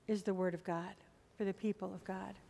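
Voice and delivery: usual speaking voice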